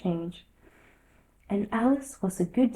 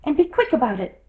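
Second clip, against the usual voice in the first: impatient Hatter; 5 semitones higher, 6 dB louder, faster than usual. A narrator's reading.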